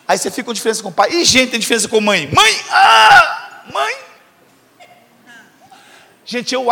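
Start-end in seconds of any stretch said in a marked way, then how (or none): 2.3-4.0 high, loud whiny call for mom; child calling out. Retold or imitated. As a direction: wail for mom like a needy kid. Filho chamando a mãe. (chamado agudo e alto pela mãe, como filho manhoso)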